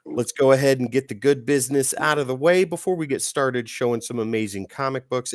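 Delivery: ordinary talk, nothing marked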